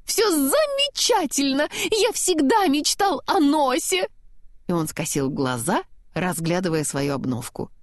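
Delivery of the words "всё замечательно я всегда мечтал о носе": high, slow, stretched-out delight; overjoyed. A narrator's reading. Snowman happy with his new nose, overjoyed. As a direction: rejoice with every key word drawn out high. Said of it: "снеговик был просто счастлив".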